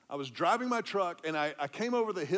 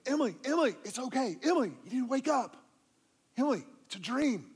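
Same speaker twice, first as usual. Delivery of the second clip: urgent coaxing; 7 semitones higher, faster than usual. Retold or imitated. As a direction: wake her gently but firmly. Waking daughter from nightmare.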